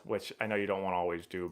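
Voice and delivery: ordinary talk, nothing marked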